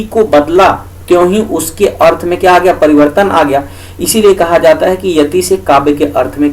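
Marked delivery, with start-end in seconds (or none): none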